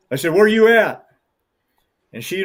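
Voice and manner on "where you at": louder, higher call across the canyon; shouting to a child out of sight. Retold or imitated. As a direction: call out to find where she is. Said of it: "I called to her".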